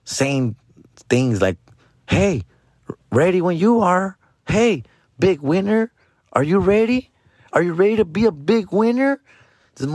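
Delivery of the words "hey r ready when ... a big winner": higher, sing-out machine voice; imitating a chirpy arcade come-on; talking slot machine. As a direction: lure the player with rising questions. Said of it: "I keep hearing this fucking machine talk".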